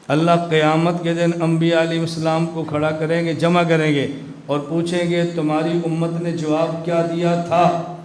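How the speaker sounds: ordinary talk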